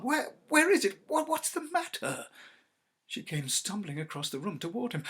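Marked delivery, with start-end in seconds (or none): 0.0-2.3 high startled stammer; jolted awake and alarmed. Acted. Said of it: "He started up in alarm".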